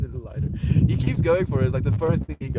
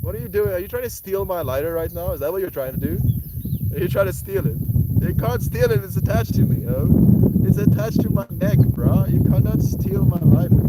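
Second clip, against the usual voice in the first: high, animated pretend outrage, getting louder; mock scolding; 8 semitones higher, 7 dB louder than usual. Streamer to pet going for the lighter, mock scolding. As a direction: playfully tell off a little thief.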